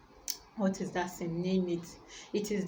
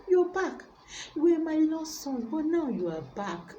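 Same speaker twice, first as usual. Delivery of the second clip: joyful father; 8 semitones higher, 3 dB louder than usual. Acted. Father overjoyed at son's return.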